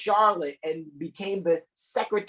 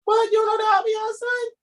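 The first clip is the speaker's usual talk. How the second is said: very high, loud wailing disbelief; mock shock; 4 dB louder than usual. Host wailing in mock shock at a co-host who doesn't know. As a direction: cry out in exaggerated disbelief.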